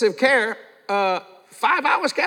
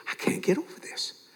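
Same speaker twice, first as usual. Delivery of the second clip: hushed whisper; 3 semitones higher, 10 dB quieter than usual. Retold or imitated. Amazed nurse speaks softly.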